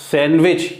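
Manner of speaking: सैंडविच as "san-wich"; silent D. Shown as a demonstration. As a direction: drop the d in सैंडविच. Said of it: "इसका उच्चारण जो होगा". (सैंडविच में डी silent)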